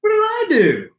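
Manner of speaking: very high mock-innocent squeak; high falsetto mock protest; squeaky high voice. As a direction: squeak it like a wounded cartoon. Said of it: "Why do I talk like this?".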